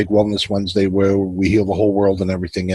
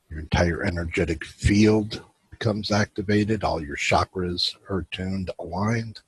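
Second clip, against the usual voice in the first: guided meditation. Acted. Host guiding his viewers into relaxation, calm guided meditation. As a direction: ease the listeners into stillness.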